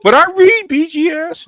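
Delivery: very high, slow, drawn-out whine; mock protest. Man mimicking someone told to go read, high mock whine. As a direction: imitate an objector in a loud squeaky falsetto.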